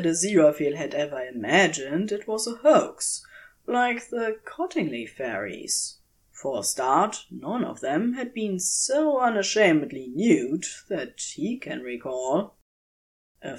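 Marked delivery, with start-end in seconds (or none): none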